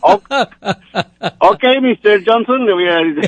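teasing in a put-on American accent; mock gringo voice. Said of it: "joking about his English".

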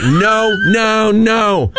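a panicked no, pushed up high; retold yelling. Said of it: "I keep yelling at them".

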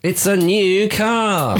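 slow, drawn-out host announcement; game show host. Pretend. Friend hamming up a game show prize reveal.

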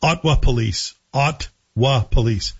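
"Ottawa" as two syllables, "Ot-wa"; dropped vowel in Ottawa. Shown as a demonstration. Host mimics news anchors, "Ottawa" shortened to "Ot-wa". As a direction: say "Ottawa" with no middle vowel, Ot-wa police. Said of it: "I've started to do it".

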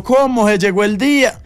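overjoyed at the news; mock delighted. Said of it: "Y yo". (emoción exagerada, más aguda y fuerte)

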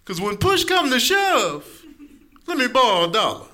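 high put-on buddy voice, slow and sure; friend misusing sayings. Friend borrowing a dollar, in a high goofy voice. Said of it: "he'd be like".

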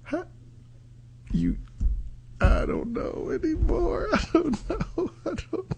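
high, soft giggling despair; exasperated laugh. Real, not acted. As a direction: laugh helplessly at the absurdity.

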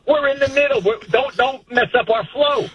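high-pitched mock impatience; dismissive mother. Mother interrupted during pre-gaming by son, mock dismissive. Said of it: "so dismissive".

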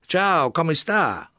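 high, slow, sing-song Italian hello; bright Italian greeting. A narrator's reading. Italian artist greeting tourists, bright and slow. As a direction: greet them warmly like a cheerful Italian artist. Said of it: "he exclaimed brightly".

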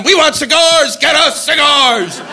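high, slow mock crowd chant; crowd screaming. UN crowd screaming demands, mock chant. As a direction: yell it like a rowdy crowd. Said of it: "they were screaming at".

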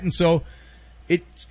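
ordinary delivery

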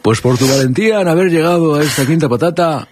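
playful headmaster proclamation; mock ceremonial announcement. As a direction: award house points with grand pomp. Host awards mock Hogwarts points.

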